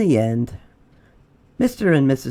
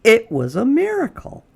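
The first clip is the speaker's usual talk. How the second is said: much higher and louder, ringing out; triumphant reveal; 10 semitones higher, 4 dB louder than usual. A narrator's reading. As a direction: announce the miracle with glee. Story climax announced.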